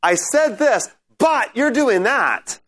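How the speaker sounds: drawn-out emphatic "but you're doing that"; emphatic contrast. Shown as a demonstration. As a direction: stress the contrast between this and that. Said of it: "One of them is a really emphatic".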